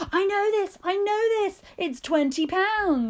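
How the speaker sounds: high-pitched, eager quiz contestant blurting it out; excited know-it-all. Retold or imitated. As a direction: retell a thrilled outburst, drawn out at the end. Acting out her excited quiz answer.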